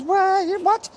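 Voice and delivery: shocked. Retold or imitated. Retelling how he reacted when his boss sent him to West Belfast, shocked. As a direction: recoil in disbelief at the news.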